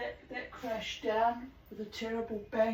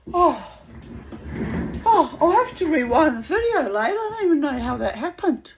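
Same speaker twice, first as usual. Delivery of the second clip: louder, higher shocked outburst; startled exclamation; 8 semitones higher, 11 dB louder than usual. Real, not acted. Owner startled as the perch crashes, loud and high. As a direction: blurt it out in sudden alarm.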